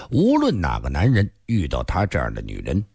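plain reading voice

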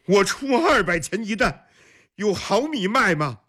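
high-pitched call from a crowd. A narrator's reading. Angry customer outside the grain shop, calling out. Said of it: "有人在喊".

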